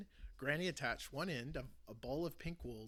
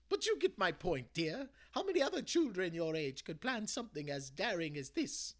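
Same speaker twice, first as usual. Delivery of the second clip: higher, louder loving grandmother's voice; reassuring granny; 6 semitones higher, 7 dB louder than usual. Acted. Granny hugging her grandson and reassuring him, tender. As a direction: comfort him like a proud granny.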